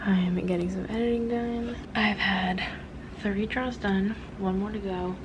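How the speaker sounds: soft hushed whisper, slowing down. Real, not acted. Filming quietly while others sleep.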